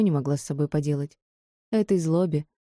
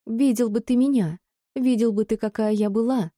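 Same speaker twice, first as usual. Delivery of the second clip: shouted, higher and angry; shouting; 6 semitones higher than usual. Acted. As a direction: yell it in his face. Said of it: "закричала она в лицо хозяину шоу".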